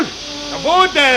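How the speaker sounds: high urgent shout. Acted. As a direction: shout back over the noise. Shouting over noise.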